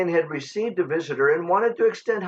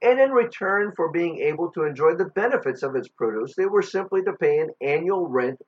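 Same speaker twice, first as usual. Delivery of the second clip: ordinary talk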